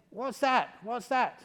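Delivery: high, loud call of a policeman. Retold or imitated. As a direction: shout it up into the dark tree. Police shine flashlight, call out.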